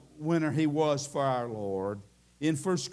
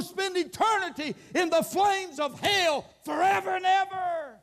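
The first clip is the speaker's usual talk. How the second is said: preaching at full force; 11 semitones higher than usual. Acted. Preacher warning the church about hell, shouting high. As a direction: thunder it at the congregation.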